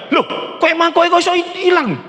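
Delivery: sudden high-pitched surprise at the missing cake; surprised question. Acted. Man discovers his kue mangkok gone, surprised high voice. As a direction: gasp it out as if the cakes just vanished.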